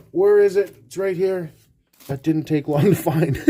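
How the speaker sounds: ordinary talk, nothing marked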